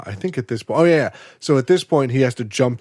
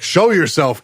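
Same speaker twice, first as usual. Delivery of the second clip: raised, louder mock call-out; retold challenge; 6 semitones higher, 5 dB louder than usual. Retelling Jack challenging the hidden voice, raised mock call-out.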